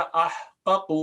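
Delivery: احقق in two beats, ah-QA-qu; slow, uncontracted احقق with each q voiced apart; original unmerged form. Shown as a demonstration. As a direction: sound both qafs of احقق separately. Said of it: "اصل میں تھا".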